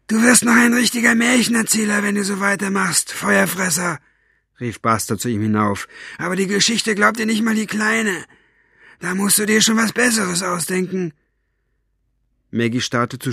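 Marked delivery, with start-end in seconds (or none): 0.1-11.1 much higher, nasal-free thug voice, mocking; sneering Buster. A narrator's reading. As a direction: jeer up at the prisoner in a high put-on voice. Buster mocks Staubfinger.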